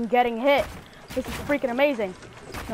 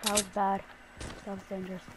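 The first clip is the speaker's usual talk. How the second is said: normal talking voice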